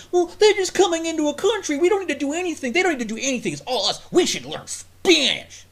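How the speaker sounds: mock liberal. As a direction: ham up a naive activist's argument. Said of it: "What about your acceptance".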